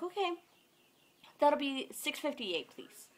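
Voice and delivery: lightly sing-out the total; cashier voice. Retold or imitated. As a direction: put on a polite register voice. Cashier addressing customer in story.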